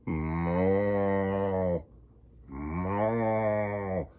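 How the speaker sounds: low mooing cow. Acted. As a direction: moo it deep like a cow. Cow in story.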